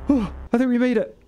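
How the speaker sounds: high, fast relieved cheer; breathless joy at surviving; excited relief. Real, not acted. Relief after escaping flood.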